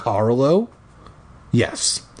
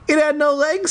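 very high, quicker playful repeat; squeaky repeat. Pretend. Reader repeating the line to the cat, very high. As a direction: pitch it way up and lift the end.

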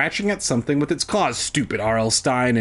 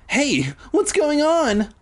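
high, slow alarmed cry; startled yelp of alarm; frightened cry; 14 semitones higher, slower than usual. A narrator's reading. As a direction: cry out in sudden fear. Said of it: "I cried".

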